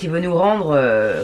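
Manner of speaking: ordinary delivery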